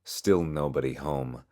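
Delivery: ordinary delivery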